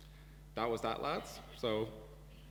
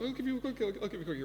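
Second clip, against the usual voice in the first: much higher, panicky squeak of an offer; flustered offer; 11 semitones higher, much faster than usual. Retold or imitated. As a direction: squeak it out in nervous haste. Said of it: "and Ross goes".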